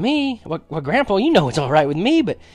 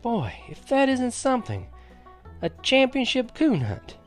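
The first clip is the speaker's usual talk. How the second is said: wide-eyed wonder; 13 semitones higher than usual. A narrator's reading. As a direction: marvel at it, pitched well up. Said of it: "Wide-eyed, I asked".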